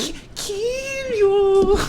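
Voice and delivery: very high, slow ghostly wail; creepy monster voice. Retold or imitated. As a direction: drag it out like a haunting creature.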